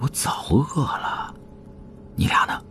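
slow, drawn-out whispered grumble; hushed. A narrator's reading. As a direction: whisper it privately to two companions. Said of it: "吕川又小声说". (小声嘀咕，拖着气声)

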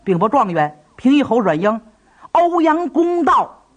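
hurried soldier's cry, rising high at the end; a soldier blurting out an arrival; flustered report. Acted. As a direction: burst in with urgent news. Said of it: "慌慌张张报".

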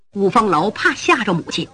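ordinary delivery

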